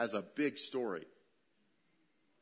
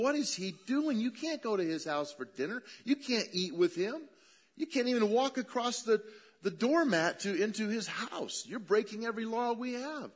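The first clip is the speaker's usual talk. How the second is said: outraged Pharisees; 10 semitones higher than usual. Retold or imitated. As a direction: squawk like shocked Pharisees. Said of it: "the Pharisees are freaking out".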